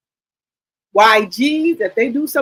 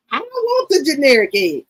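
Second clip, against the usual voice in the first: higher, put-on stubborn mother; mama's voice; 5 semitones higher than usual. Daughter imitating mama's refusal in a higher voice. Voicing mama again.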